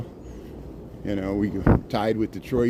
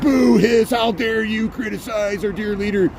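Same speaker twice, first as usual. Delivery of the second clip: booing crowd voice, higher and louder at the start; mocking outraged crowd; 9 semitones higher, 3 dB louder, slower than usual. Vlogger mimicking critics who boo him, sarcastic crowd voice. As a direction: send up offended fans with a mock boo. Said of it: "And everybody's like".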